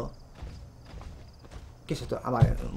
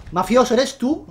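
calling out; 7 semitones higher, 5 dB louder than usual. Real, not acted. Calling out to a player.

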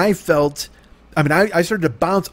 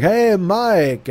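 high, drawn-out greeting in mock German accent; German-accented neighbor; 7 semitones higher than usual. Neighbor greets him at the gate, imitated German accent. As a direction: sing out the hello, lightly German. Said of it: "he sounds like he's German".

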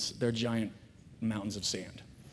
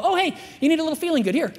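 mock casual God; 10 semitones higher, 4 dB louder, much faster than usual. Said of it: "He didn't go".

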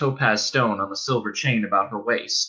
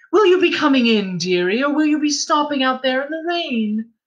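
put-on much higher, louder motherly old-woman voice; friendly innkeeper's wife; 13 semitones higher, 4 dB louder than usual. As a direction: coax the girl in from the rain. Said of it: "The woman's friendly voice".

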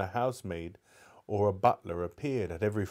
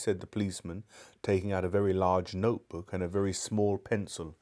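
usual speaking voice

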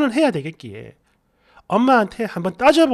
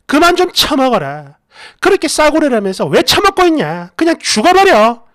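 rising furious shout, higher and louder to the end; screaming at mom; 4 semitones higher, 7 dB louder than usual. Retold or imitated. Daughter screaming at her mother over the wine, furious shout. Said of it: "미친 사람처럼 막 울면서 소리 지르고".

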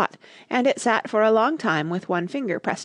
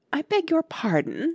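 frowning but polite; 10 semitones higher than usual. A narrator's reading. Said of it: "frowning, but very politely".

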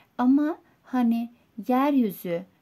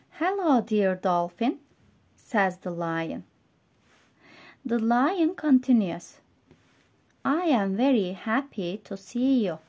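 story reading for learners. A narrator's reading. Reads English story to learners.

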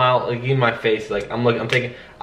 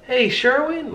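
softer, higher and slow friendly greeting; gentle; 12 semitones higher, 4 dB quieter, slower than usual. Shown as a demonstration. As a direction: ease in gently to lower the guard. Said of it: "lower your, become gentle".

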